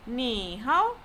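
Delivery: scoop "Ni hao" down and up; slow demonstration of dipping "Ni hao"; falling-rising tone. Shown as a demonstration. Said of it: "falling rising tone".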